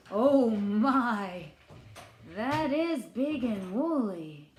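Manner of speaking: playful wow; mock amazement. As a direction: marvel at it for the kids. Reacting to picture of big dog.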